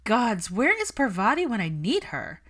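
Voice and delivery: loud, delighted cackle of a line; gleeful. A narrator's reading. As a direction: relish the friend's embarrassment. Pansy delighted at discomfort.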